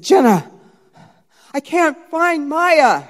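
loud, high, drawn-out call to a sister; heightened alarm. Retold or imitated. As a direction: call out in rising panic, slowly. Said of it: "gotten to a little heightened state".

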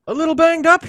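very high, loud mocking repeat; shrill loud disbelieving echo; incredulous echo. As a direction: throw his words back in disbelief. [name] echoes in disbelief.

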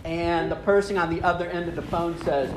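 ordinary delivery

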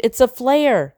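high, urgent repeated scream; screaming again. Acted. Tumble repeats her scream at Greg over the burning bag. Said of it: "she screamed".

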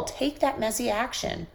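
ordinary talk

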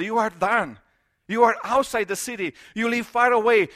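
ordinary talk

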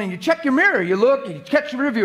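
usual speaking voice

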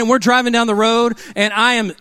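ordinary talk

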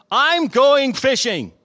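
loud, high, drawn-out announcement; declaring. A narrator's reading. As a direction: announce it slowly and boldly to the group. Peter announces in Bible reading.

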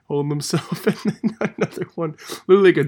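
giggling while telling it, loud finish; laughing. Real, not acted. Cracks up retelling the hit.

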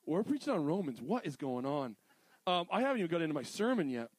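ordinary delivery

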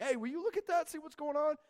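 put-on high excited call to the kid. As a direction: point it out to a child, pitched high. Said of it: "I was like".

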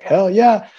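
high, loud retold cheer; excited cheer. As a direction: burst out, thrilled at backup coming. Retold reaction to jets coming.

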